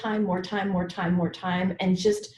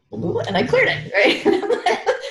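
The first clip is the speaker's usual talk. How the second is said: high laughing delivery; amused by her own success; 12 semitones higher, 4 dB louder, much slower than usual. Real, not acted. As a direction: laugh through it. Laughing at the memory.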